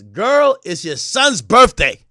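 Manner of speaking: loud, incredulous clapback; loud mock scolding. Acting out [name]'s clap back.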